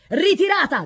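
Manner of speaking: high, loud military call; ringing battlefield order; shouted retreat. A narrator's reading. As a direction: call the troops back. Retreat called after horn sounds.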